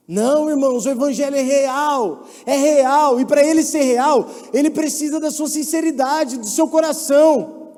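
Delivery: loud, emphatic sermon climb; full-force preaching. Acted. Preacher urging brothers to sincerity, loud and fervent. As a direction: preach it with fire.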